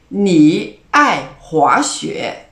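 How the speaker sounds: 我爱运动 said very slowly and loudly, each tone clear; slow model sentence. Shown as a demonstration. Teacher giving an example sentence, loud and slow. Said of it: "Un autre exemple".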